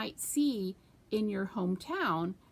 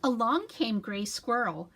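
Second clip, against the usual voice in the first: usual speaking voice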